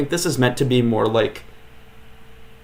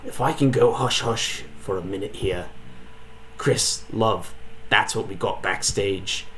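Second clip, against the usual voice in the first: a hammed-up rural British accent, often hushed; bad rural British accent. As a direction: play a cheeky British showman in a shaky British accent. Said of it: "Sorry for that horrible British accent".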